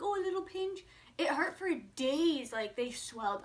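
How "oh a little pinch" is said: mock casual. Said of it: "I knew it would be like".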